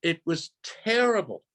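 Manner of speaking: usual speaking voice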